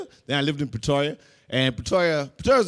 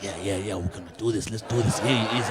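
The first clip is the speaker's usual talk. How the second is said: low, hushed psyching-up; mock robbers' low huddle; robbers amping up; 7 semitones lower, 3 dB quieter than usual. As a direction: repeat it low, like partners egging each other on. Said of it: "amping each other up".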